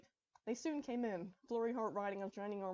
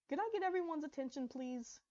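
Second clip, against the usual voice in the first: addressing crowd; 7 semitones higher than usual. Acted. Speech at microphone.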